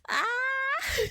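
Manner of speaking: sudden very high, loud squeal; embarrassed squeal. Real, not acted. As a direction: let out a flustered squeal. Embarrassed outburst after laughing.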